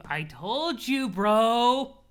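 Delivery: slow, loud sing-song gloat; drawn-out meme taunt. Acted. Gloating friend teases Dave, slow and loud. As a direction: stretch it out to rub it in.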